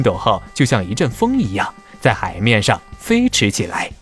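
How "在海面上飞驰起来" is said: usual speaking voice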